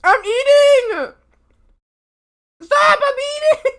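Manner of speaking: loud high shout. Real, not acted. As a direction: yell it out, startled. Interrupted while eating on stream.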